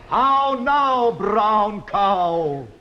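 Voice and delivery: "How now, brown cow?" with round clipped posh vowels; elocution model. Shown as a demonstration. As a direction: demonstrate "How now, brown cow?" in plain received pronunciation. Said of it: "say like me".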